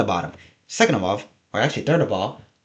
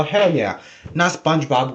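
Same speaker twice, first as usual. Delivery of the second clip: normal talking voice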